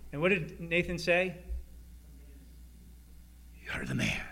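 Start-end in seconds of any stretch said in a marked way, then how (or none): 3.6-4.2 much lower, quiet and weighty; grave accusation. Retold or imitated. Nathan accusing David, low and grave. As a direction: drop the voice and point the finger.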